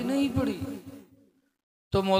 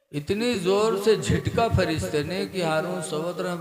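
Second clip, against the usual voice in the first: ordinary delivery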